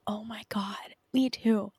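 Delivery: breathy whispered agreement. Real, not acted. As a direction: whisper it like a secret. Shared excitement about episode.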